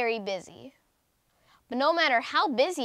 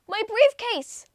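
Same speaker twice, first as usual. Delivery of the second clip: high, louder sudden panic; alarmed cry; 9 semitones higher, 6 dB louder, slower than usual. Acted. Businessman finds his briefcase gone, alarmed cry. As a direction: gasp it out as the loss hits. Man discovers briefcase missing.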